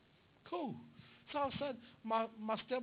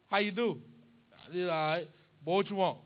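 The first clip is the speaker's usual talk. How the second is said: loud, gruff dad cutting to the chase; impatient dad; 2 semitones lower, 7 dB louder than usual. Retold or imitated. Son calls dad, dad loud and impatient. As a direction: bark it like a dad who knows you want money.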